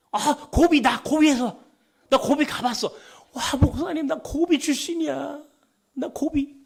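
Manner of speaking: high-pitched, loud burst of delight; excited about hometown. Retold or imitated. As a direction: act out someone thrilled to hear of home. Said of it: "신나, 고향 얘기". (신이 나서 높은 목소리로)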